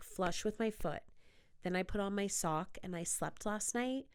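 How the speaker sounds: normal talking voice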